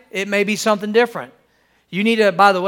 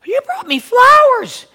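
high, louder gushing disbelief; shocked delighted wife; 13 semitones higher, 5 dB louder, slower than usual. Acted. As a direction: gush in an imitated wife's much higher voice. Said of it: "she's shocked. She can't believe it".

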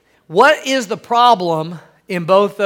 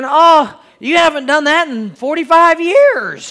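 imitated wife's higher, amazed gushing; shocked wife; 7 semitones higher, 5 dB louder than usual. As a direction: marvel in a raised wife voice. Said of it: "she's shocked. She can't believe it".

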